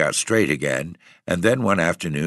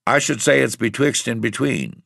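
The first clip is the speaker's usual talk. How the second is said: higher, mildly puzzled countryman; puzzled landlord; 7 semitones higher than usual. A narrator's reading. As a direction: mull it over lightly. Landlord answers, puzzling it over.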